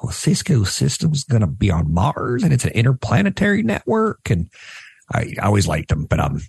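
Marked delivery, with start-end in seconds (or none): 0.0-4.3 lightly imitated Southern accent, pitch rising high; mild Southern accent. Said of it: "he had this Southern accent".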